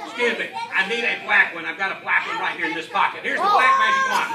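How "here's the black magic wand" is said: loud showman announcement, laughing; showman shout. Acted. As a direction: boom it out like a stage magician. Magician's showy announcement again.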